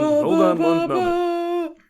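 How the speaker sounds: high, slow pompous voice; pompous gentleman. Acted. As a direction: halt them with mock grandeur. Player puts on grand voice.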